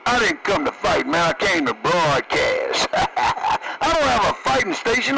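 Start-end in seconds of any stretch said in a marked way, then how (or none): 2.9-5.2 words pushed out through a laugh, higher. Real, not acted. Trucker laughing to his CB buddy that he came to broadcast. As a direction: laugh your way through the line. Laughing while joking on air.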